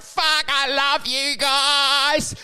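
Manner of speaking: put-on slow, drawn-out drunken gush; mock gushing friends. Friends commenting on the post, slow mock gush. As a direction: stretch it out like a sloppy toast. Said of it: "friends going like".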